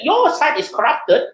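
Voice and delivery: mocking opponent. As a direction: sneer back like a rival politician. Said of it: "the response from the other side is like".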